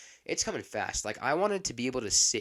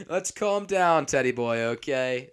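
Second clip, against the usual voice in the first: louder, higher-pitched jolly intervention; imitated peacemaking friend; 4 semitones higher, 7 dB louder than usual. Imitating friend calming the angry old man, loud jolly placating. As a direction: play the friend heading off an explosion. Friend laughingly steps in to calm him.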